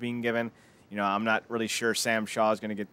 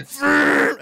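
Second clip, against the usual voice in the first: loud, high mocking bark of Fluke; mock shout; 10 dB louder than usual. Imitating Roode's Fluke, loud and high. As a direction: blurt it like the angry champ. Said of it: "he's like".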